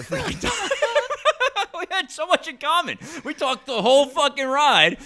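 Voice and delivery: high, loud laughing through the words; loud, helpless storytelling laughter. Real, not acted. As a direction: crack up while telling it. Laughing at own story's climax.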